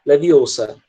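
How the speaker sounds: Leviosa with shorter, flatter American vowels. Retold or imitated. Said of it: "bem mais curto".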